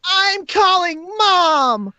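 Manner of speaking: put-on very high, stretched-out childish yell; yelling ghost kid. Ghost kid threatening to tell Mom, high drawn-out yell. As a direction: wail it high and slow like a tattling kid. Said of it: "The other one yells".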